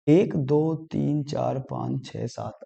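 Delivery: counting letters softly under his breath; muttered counting. Real, not acted. Teacher counts letters to himself, quietly muttering. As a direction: drop to a low murmur while counting.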